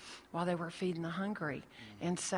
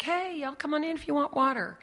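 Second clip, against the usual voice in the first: calling out; 8 semitones higher, 6 dB louder than usual. Retold or imitated. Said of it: "It's like".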